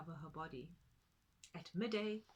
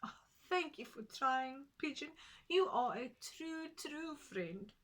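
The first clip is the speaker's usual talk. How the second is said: Tutu the tortoise; 6 semitones higher, 4 dB louder than usual. Acted. Tutu grateful, near tears.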